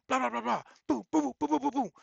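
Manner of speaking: high playful imitation of punches landing; high mock fight noises. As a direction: bang out the fight noises like a brawl.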